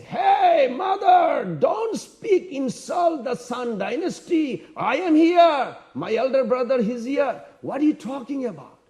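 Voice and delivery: put-on high, loud challenge from a defiant young prince; indignant outburst. Young prince rebukes a mother who doubted his dynasty, indignant outburst.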